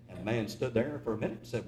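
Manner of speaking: usual speaking voice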